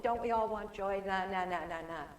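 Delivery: ordinary delivery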